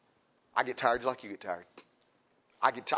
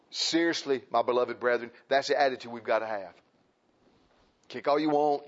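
ordinary delivery

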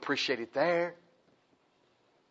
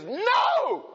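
high, loud shouted refusal; stubborn flesh shouting; 5 dB louder than usual. Acted. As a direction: scream no like a stubborn child.

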